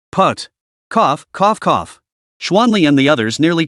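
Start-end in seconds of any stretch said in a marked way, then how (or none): 0.9-2.0 sudden choked coughs; coughing fit. A narrator's reading. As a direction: splutter and cough. Choking on the words.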